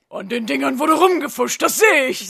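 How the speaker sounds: lightly voiced big groundskeeper; Hagrid. Hagrid judging the bludgers, lightly voiced and loud. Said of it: "sagte Hagrid".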